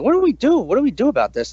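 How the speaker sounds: mock panic over the baby's rash; panicked asking. Worried parents asking the doctor, high and frantic.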